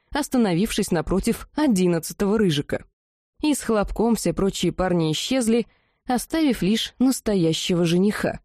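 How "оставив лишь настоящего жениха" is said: ordinary delivery, nothing marked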